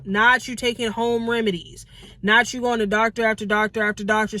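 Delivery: ordinary talk, nothing marked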